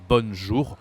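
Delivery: "bonjour" with a pronounced n; common mispronunciation. Shown as a demonstration. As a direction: copy learners saying "bonn-zhour", vowel not nasal. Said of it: "A lot of people, they say".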